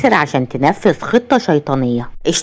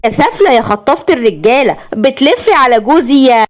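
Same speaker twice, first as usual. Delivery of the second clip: higher, shrill-edged furious insults at a rival; screaming insults; 6 semitones higher than usual. Acted. Jealous wife screams at the other woman, furious. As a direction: spit the insults at her. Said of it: "وتصرخ فيها وتقول".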